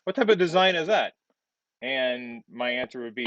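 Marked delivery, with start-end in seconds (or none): 0.0-1.1 higher, louder voice of a puzzled questioner; questioning student. Acted. Student questions Chris about the design, higher questioning voice. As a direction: act out a student challenging the design, pitched up. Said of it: "you may, may say to yourself".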